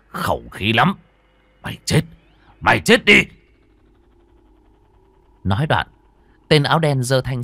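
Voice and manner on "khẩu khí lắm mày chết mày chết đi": sneer, then scream; taunting then shouting. Acted. Villain finishes off Minh.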